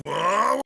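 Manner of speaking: drawn-out "whoa" yell, rising high and loud; battle scream. Acted. Player voices his character's rage attack, battle scream. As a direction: let out a long, acted war cry. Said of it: "It lasts as long as he screams".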